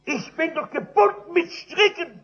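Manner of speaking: loud, high anguished plea; desperate cry. Retold or imitated. Drunkard crying out to the missionary that he is bound, loud and high. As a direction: cry out that you are helpless. Drunk man pleading his helplessness.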